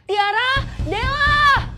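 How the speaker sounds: loud, high shout of two names; calling out. Acted. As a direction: yell the names across the room. Trapped, calling out friends' names. (berteriak memanggil nama, keras dan tinggi)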